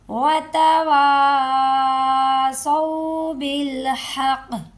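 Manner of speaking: slow, drawn-out tajweed chant; Quran recitation. Shown as a demonstration. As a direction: recite it like a qari, stretching each sound. Recitation demo. (धीमी तजवीद वाली तिलावत)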